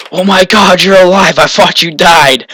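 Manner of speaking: high, loud, astonished retelling; amazed disbelief. Retold or imitated. Friend finds swimmer alive below the dam, astonished.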